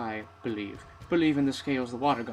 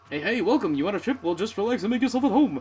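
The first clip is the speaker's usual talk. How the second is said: loud, high, bubbly welcome; eager greeter; 5 semitones higher, 6 dB louder, faster than usual. Acted. Wutai greeter welcomes the tourists, loud and bubbly. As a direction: sell the trip like a pushy host.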